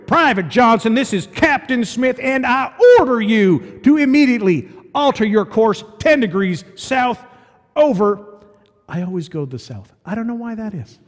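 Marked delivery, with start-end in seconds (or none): 0.1-8.2 loud, higher furious radio order; captain shouting. A narrator's reading. Captain shouting his order back at the private. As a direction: bark the order across the water. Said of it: "the captain shouted back".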